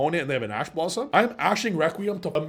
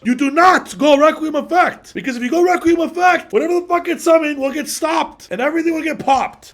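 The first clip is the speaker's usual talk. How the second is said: much higher and louder insistent warning; 13 semitones higher, 11 dB louder than usual. Real, not acted. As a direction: yell the warning at the viewer. Warning against a misplay.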